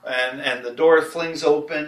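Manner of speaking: ordinary delivery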